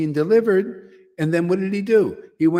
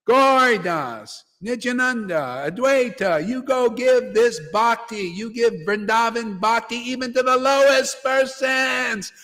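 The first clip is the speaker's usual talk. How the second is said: rousing proclamation; 5 semitones higher than usual. Retold or imitated. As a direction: send out the disciples with a ringing command. Proclaims Lord's command to disciples.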